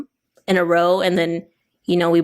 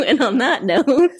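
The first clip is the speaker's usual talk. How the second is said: slow, higher co-host impression; drawn-out mimicked sign-off, pitched up; Liana; 6 semitones higher, 4 dB louder than usual. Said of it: "do my best Liana impression".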